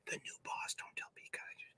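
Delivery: secretive stage whisper; conspiratorial whisper behind a friend's back. Acted. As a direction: hush it so Pikachu won't hear. Secret aside about Pikachu.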